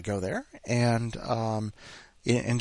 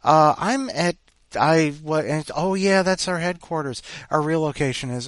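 higher, louder flustered back-and-forth; flustered phone call; 6 semitones higher, 6 dB louder than usual. Retold or imitated. Calling the dental office from the wrong building, flustered. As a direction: stumble through it, confused. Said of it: "Call them up".

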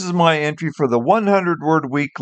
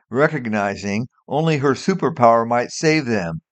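ordinary delivery

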